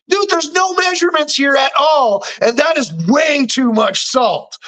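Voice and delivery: high-pitched incredulous protest; mock outrage. Viewer objecting to the salty recipe, mock outrage. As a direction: protest in comic disbelief.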